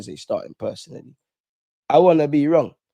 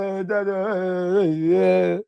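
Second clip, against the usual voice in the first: mock sweet greeting; 5 semitones higher, much slower than usual. Greeting dad after the lesson.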